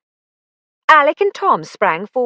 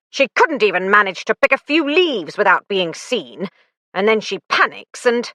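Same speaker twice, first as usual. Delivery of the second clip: fast, raised babbling; 3 semitones higher than usual. Acted. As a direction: rush out the excuses. Rupert babbling excuses.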